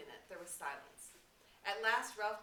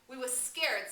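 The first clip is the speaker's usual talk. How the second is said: higher and louder, rushed protest; excited; 7 semitones higher, 12 dB louder, slower than usual. A narrator's reading. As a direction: blurt it out, all worked up. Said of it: "said Piggy excitedly".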